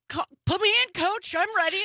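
high-pitched mock pleading to play; eager player. Host playing a player begging the coach, eager and high. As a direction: call out like a keen benchwarmer.

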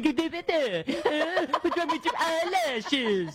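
very slow, high laughing mock voice; dad impression. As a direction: play her father gently mocking. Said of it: "picture my father on the House of Congress going".

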